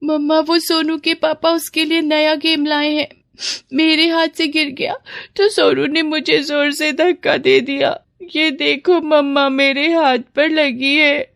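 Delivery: put-on high, tearful little girl; crying child. Daughter showing mother her hurt hand, tearful whining. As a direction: whimper out the complaint in a small high voice. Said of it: "तुम रो क्यों रही हो".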